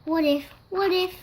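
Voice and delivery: ordinary delivery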